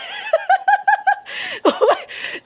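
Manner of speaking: very high, long squealing laugh. Real, not acted. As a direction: burst out in disbelief. Laughs at the trad wife story.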